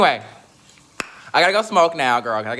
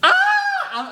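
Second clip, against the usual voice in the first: high squeal; 4 dB louder than usual. Real, not acted.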